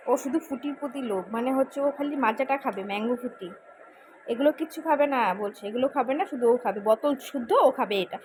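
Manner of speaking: ordinary talk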